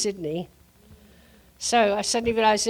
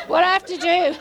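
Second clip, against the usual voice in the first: higher and louder, laughing through it; laughing question; 8 semitones higher, 6 dB louder than usual. Retold or imitated. Quoting the stunned bus driver to the ladies, laughing and louder. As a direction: ask it while laughing.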